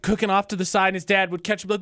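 ordinary talk

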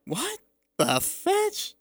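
very high, slow squeak of disbelief; high mock-shocked child; shocked daughter. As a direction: squeal it like a startled kid. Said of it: "Your, your daughter's like".

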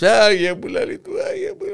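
mock wailing. Grandpa wails in the joke.

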